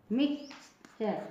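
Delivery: "मिक्सचर" slowly, split as miks-char; "मिक्सचर" drawn out with a clear ch; TURE as "char". Shown as a demonstration. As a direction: say "मिक्सचर" softly in two parts, ending in char. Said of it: "जैसे मिक्सचर".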